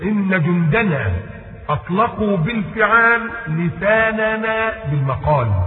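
slow lofty recitation of إن جندنا; grand declamation. Retold or imitated. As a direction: proclaim it like a famed orator. Repeats orator's line more grandly.

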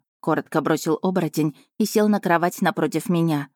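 ordinary delivery, nothing marked

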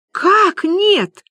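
high, loud cry of disbelief; astonished. Acted. Люба hears the signature is denied, astonished. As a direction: burst out, pitch leaping up. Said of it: "поразилась Люба".

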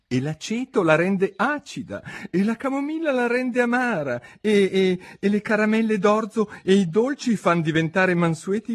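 delighted by her own cleverness; pleased with herself. A narrator's reading. Alice lists her new rules, eager and pleased.

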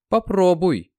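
doubtful. A narrator's reading. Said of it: "с сомнением проговорила Марьяна".

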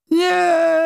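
high, anguished cry; anguished scream. Acted. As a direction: scream in despair. Vampire sees car explode.

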